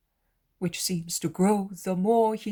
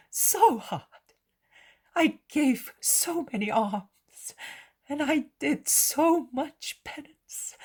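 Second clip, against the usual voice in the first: high, emotional mother, louder than usual; overjoyed, reviving; 6 semitones higher, 5 dB louder than usual. A narrator's reading. Mother thanks Jesus for loving her son, overjoyed. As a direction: pour out relief and joy in a high voice. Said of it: "Mary resembles a withered flower that revives".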